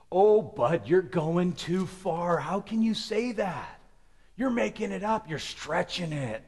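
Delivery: a hint of a doubting listener, drawn out; mock skeptic. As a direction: play the doubter pushing back. Voicing a skeptic objecting.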